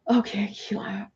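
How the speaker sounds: whispered to herself; soft whispered aside. Real, not acted. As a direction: hush it while choosing. Picking a viewer to read.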